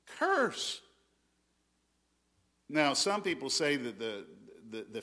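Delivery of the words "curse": "Curse." much higher, hammered alone; "Curse." pitched up high for weight; emphatic repeat. Shown as a demonstration. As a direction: land hard on "Curse." as a single word. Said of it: "he began to curse and swear".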